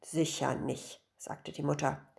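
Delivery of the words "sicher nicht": low, soft, slow reassurance; unruffled lower-voiced mother; calm dismissal. A narrator's reading. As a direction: brush it off quietly. Mother calmly doubts wailing daughter.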